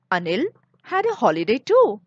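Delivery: ordinary delivery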